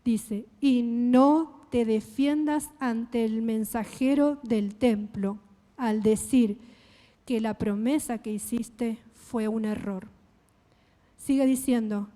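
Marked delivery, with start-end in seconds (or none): 0.6-10.1 slow, measured scripture reading. Shown as a demonstration. Preacher reads on through the verse for the congregation, slowly. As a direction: read it slowly, phrase by phrase. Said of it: "Y lo leo despacio".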